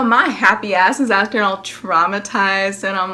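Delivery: ordinary talk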